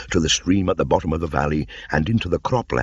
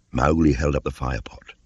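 ordinary delivery, nothing marked

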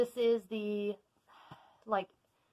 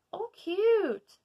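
high, bright-eyed "oh, cute"; sudden delighted coo; delighted squeal; 12 semitones higher, 7 dB louder than usual. Real, not acted.

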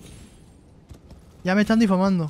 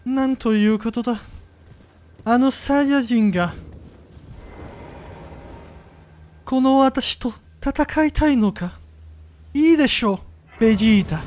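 Vegeta impression; 5 semitones higher than usual. Streamer imitates Vegeta for a viewer in Japanese, high and proud. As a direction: sneer it out like a proud Saiyan prince. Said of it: "Imitando, mira, mira".

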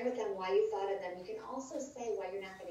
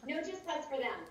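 quoting Linda; 7 semitones higher than usual. Retold or imitated. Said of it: "as Linda Evening said".

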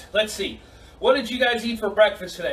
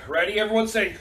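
normal talking voice

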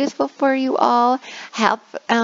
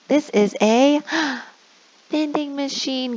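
higher gasp before "vending machine"; mock gasp reveal; 6 semitones higher than usual. Shown as a demonstration. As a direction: act amazed for the kids. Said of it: "you go through each one".

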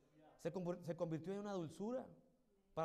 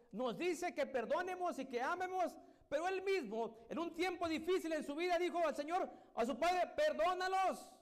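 building preach; 7 semitones higher than usual. Real, not acted.

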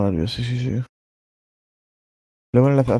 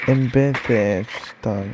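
slow mocking echo; much slower than usual. Slow echo of friend's words.

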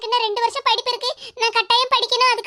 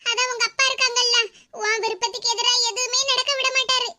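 ordinary talk, nothing marked

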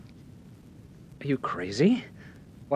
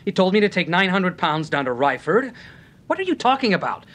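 heated protest; 5 semitones higher than usual. Acted. Bellamy protesting to Temple, heated and indignant. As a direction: fight the accusation with rising heat.

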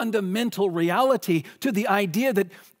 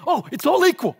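mock glib answer; 8 semitones higher, 5 dB louder, faster than usual. Mocking a shallow reading.

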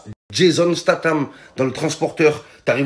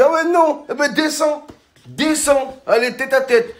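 challenge; 11 semitones higher than usual. Retold or imitated. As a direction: dare him to step down, pitched up. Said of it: "Je dis".

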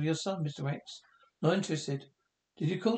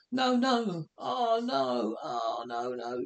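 strained, pitched-up fear; panicked no; much slower than usual. Acted. Realizing the danger.

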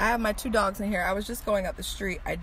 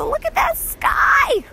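very high, slow, loud exclaim; excited exclaim; 9 dB louder, slower than usual. Real, not acted. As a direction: gush about the sky. Excited about weather.

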